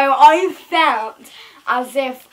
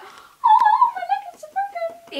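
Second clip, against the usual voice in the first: mock wailing. Pretending her leg is broken.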